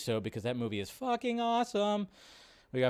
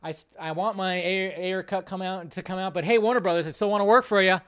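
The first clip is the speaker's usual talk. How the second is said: higher, louder pleading to the studio; voicing the director; 4 semitones higher, 7 dB louder than usual. Imitating director pleading with Warner Brothers, hammed-up. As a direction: play him nervously sucking up to Warner Brothers. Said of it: "He's still trying to be like".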